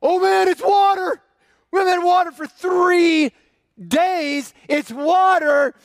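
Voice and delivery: high, loud cry of thirsty joy; overjoyed shout. Retold or imitated. Thirsty wanderer sees water, shouting with joy. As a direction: yell it like you've just spotted water. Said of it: "and you're like".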